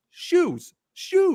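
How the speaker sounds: loud, high, desperate correction; explaining to the salon, high and loud; shouting shoes. Retold or imitated. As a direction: shout it to clear his name. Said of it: "and I go".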